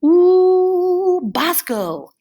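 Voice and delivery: long high loud "ooh", then back down; teacher calling on pupil. Acted. Class teacher picks a kid to answer, eager call. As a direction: draw out an excited high "ooh" before the name. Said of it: "and Ross might say".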